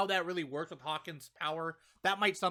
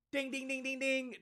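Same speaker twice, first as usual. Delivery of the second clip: higher, sing-song bell chime; ding ding; 9 semitones higher, 4 dB quieter than usual. Acted. Reviewer celebrating the end of the headaches with mock bell chimes. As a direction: chime it like a quiz-show bell. Announcing the right answer.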